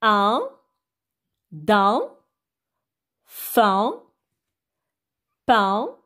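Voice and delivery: isolated syllables with rising tune, long pauses; syllable drill. Shown as a demonstration. Teacher has children follow along, slow syllable drill. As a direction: model each syllable separately, last one falling. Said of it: "faire la lecture des syllabes".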